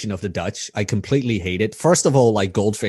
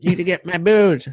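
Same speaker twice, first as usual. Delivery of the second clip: high-pitched American guy impression; Rob impression; 9 semitones higher than usual. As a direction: put on a much higher voice, mimicking an American. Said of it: "the best impression I can make".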